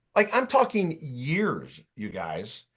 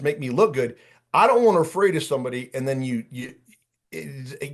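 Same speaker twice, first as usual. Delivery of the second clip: ordinary talk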